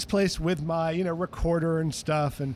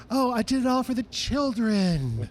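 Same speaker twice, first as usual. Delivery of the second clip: sweet, much higher public Ray voice; imitating [name]; 6 semitones higher than usual. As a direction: play the saintly public Ray. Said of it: "being the [name] we know".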